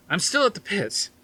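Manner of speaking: high, loud and eager; can barely contain the news; calling Harrison over, thrilled; excited. Acted. Excited Norton on the phone.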